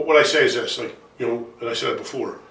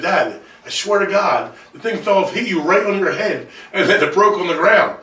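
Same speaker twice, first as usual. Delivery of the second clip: high-pitched pleading, amused; retold insistent protest; 6 semitones higher than usual. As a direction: plead your case in a funny retelling. Pleading his case to dad.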